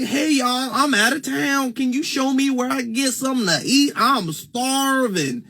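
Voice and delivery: put-on a Northerner hamming up a Southern accent; exaggerated Southern accent. Someone in New York faking a Southern accent for directions. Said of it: "if I was trying to mimic a Southern accent".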